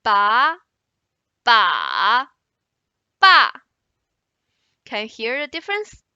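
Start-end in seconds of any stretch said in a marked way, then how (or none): 0.0-0.6 "ba" rising, loud; second tone. Shown as a demonstration. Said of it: "With four tones". 1.4-2.3 "ba" dipping low then rising; long, loud dipping "ba"; third tone. Shown as a demonstration. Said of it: "With four tones". 3.2-3.5 "ba" falling steeply from high; short, loud falling "ba"; fourth tone. Shown as a demonstration. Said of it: "With four tones".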